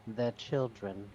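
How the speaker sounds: low, slow, soft reveal; quiet villain reveal; sinister hush. Acted. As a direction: drop the menace quietly.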